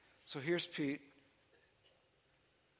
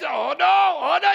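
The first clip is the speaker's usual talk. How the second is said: high, loud panicked wail; panicked cry; 10 semitones higher, 13 dB louder than usual. Acted. Pastor voicing sinking Peter, a loud panicked cry. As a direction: cry out as you start to sink.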